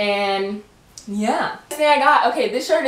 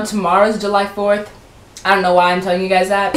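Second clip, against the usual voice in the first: ordinary talk, nothing marked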